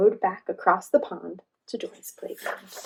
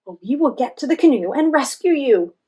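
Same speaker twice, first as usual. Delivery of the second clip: louder, higher reassuring shout; calling to a friend in the water; loud rescue promise; 5 semitones higher, 9 dB louder than usual. A narrator's reading. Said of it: "shouted Joy".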